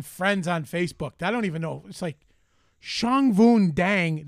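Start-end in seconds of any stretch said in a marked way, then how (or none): 2.8-4.2 drawn-out name. Retold or imitated. Reading stranger's name.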